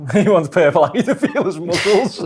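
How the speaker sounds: giggling as he teases his mate; laughing. Real, not acted. Co-host teasing his mate about Purple Aki, laughing.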